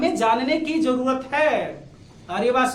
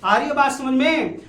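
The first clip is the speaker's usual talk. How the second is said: normal talking voice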